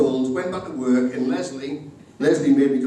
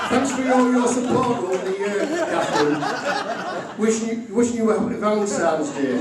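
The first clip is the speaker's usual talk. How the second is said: mock card voice; 9 semitones higher than usual. Reading the card aloud.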